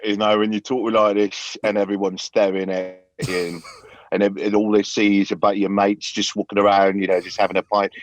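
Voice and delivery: rough London Cockney, chatty; Cockney accent. Pretend. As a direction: sound like a London lad off to the pub. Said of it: "that dirty Cockney shit?".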